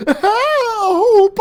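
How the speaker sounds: high, loud squeaking yelp; scared high squeal; squeaked cry for help. A narrator's reading. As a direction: squeal for help as the hook yanks you up. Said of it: "I squeaked".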